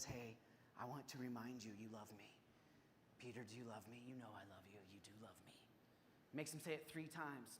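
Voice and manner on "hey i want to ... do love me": much lower and softer, fading to a whisper; Jesus quietly reminding Peter of his love; tender reassurance. Retold or imitated. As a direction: speak gently, like restoring a friend. Jesus gently restoring Peter.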